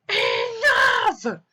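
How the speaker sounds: a king at the end of his patience, shouting; exasperated king. Acted. Fed-up king in read-aloud story.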